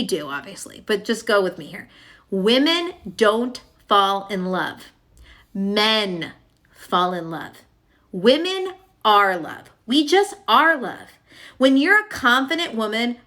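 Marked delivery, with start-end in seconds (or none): 2.3-11.1 slow, weighty lines, each word given its own beat; solemn slow emphasis. Shown as a demonstration. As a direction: lay each word down slowly, like a creed. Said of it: "slow emphatic teaching".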